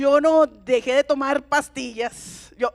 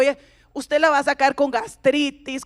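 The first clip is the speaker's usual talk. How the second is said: ordinary talk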